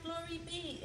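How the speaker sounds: high, exasperated cry; exasperated exclamation. Acted. Old lady despairs at her crowded house, high exasperated exclamation. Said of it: "The little old lady cried".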